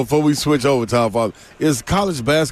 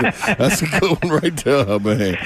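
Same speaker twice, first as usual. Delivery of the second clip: laughing. Real, not acted. Host cracking up at joke.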